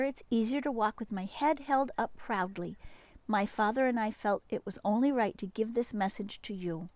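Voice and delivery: ordinary delivery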